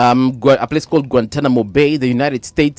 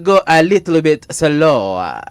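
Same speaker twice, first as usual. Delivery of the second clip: slow, deliberate mock voice of the man; drawn out, as if talking to a foreigner; imitating a slow request; 3 semitones higher, slower than usual. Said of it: "he would be like".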